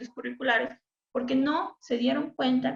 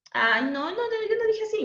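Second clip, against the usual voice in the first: rising sharply in mock disbelief; indignant denial; 12 semitones higher than usual. The speaker denying to friends that she said it wrong, with rising mock disbelief. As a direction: insist you never said it, voice climbing. Protesting she didn't misspeak.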